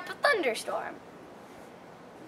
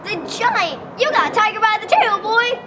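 higher, louder, fast alarmed cry; alarmed shout; 6 semitones higher, 9 dB louder than usual. A narrator's reading. Jukebox warning Jack the giant is coming, fast alarmed shout. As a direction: shout a warning as the truck pulls up. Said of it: "the jukebox screamed".

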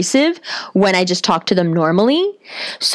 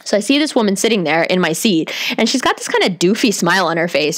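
ordinary talk

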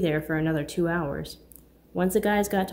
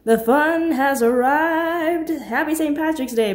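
slow, booming party cheer, much higher and louder; friendly loud greeting; 9 semitones higher, 8 dB louder, slower than usual. Acted. Friend arriving announces the party with a loud, slow cheer. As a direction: stretch it out like the life of the party arriving. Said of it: "Mark's friendly voice emanated".

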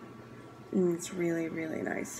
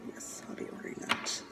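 1 whispered aside; 10 dB quieter than usual. Real, not acted. Whispered aside after picking favorite.

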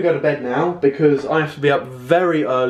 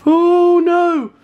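loud high shriek, then slow pleading; terrified horror victim; 10 semitones higher, 5 dB louder, slower than usual. Real, not acted. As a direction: scream and beg for mercy. Bee comes at her.

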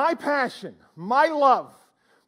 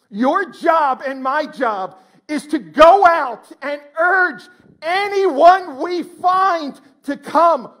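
slow, loud and higher preaching push; fervent urging; 6 semitones higher, 10 dB louder, much slower than usual. Real, not acted. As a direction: drive it home slowly and loud.

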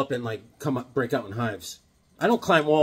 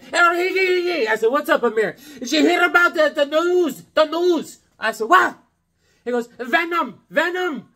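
high, eager mock voice; imitating excited Amir; 11 semitones higher, 4 dB louder than usual. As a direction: play the thrilled fan.

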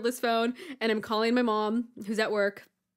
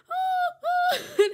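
high, loud mock wailing; imitated crying scream; 7 dB louder than usual. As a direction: wail like a screaming little sister. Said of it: "she is screaming and she is crying".

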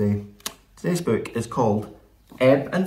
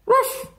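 high-pitched little dog yap; sharp, squeaky ruff; dog bark. A narrator's reading. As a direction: bark like an excited small dog. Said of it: "said Ebb".

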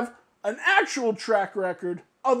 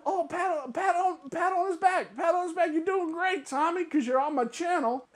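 high mocking sing-song praise; mocking buddies; 6 semitones higher than usual. Vlogger mocking friends praising Tommy on their channels. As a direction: imitate fawning back-patters. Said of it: "It's that, the".

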